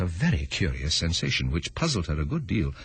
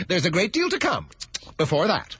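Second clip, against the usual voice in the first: rabbit voice; 10 semitones higher than usual. Acted. Rabbit voiced in read story.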